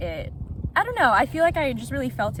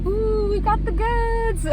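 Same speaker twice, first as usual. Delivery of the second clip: slow, high, louder gleeful ooh; excited; 12 semitones higher, 7 dB louder, slower than usual. Real, not acted. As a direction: savor the win out loud. Receiving the food bag.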